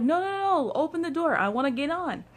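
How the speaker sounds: high, urgent no no no; urgent pleading. Retold or imitated. To the drunk guy at the door, high urgent pleading. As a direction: beg him to open up.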